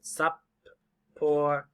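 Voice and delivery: supportive slowly as sup, por, tive; split between consonants. Shown as a demonstration. Teacher divides double P, supportive in separate syllables. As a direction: divide supportive between the two P's, pausing. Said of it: "we're gonna go between".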